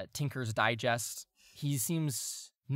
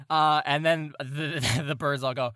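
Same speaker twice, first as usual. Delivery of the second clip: usual speaking voice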